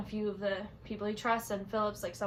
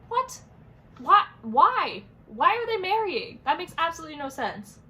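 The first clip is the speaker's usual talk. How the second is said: high, loud, disbelieving what and why; real exasperated confusion at the book's ending; can't believe the plot twist, shouting the why; baffled outburst; 7 semitones higher, 8 dB louder than usual. Real, not acted. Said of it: "I'm very confused".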